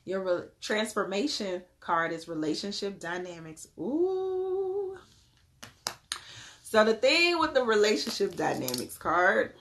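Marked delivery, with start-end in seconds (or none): 3.8-5.0 high, drawn-out ooh; intrigued ooh. Real, not acted. Reader reacts to relationship dynamics card, intrigued high ooh. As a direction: let out a long high "ooh".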